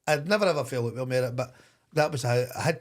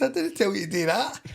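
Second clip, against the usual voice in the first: retold angry retort; 9 semitones higher, 4 dB louder than usual. Retold row with sent-off player.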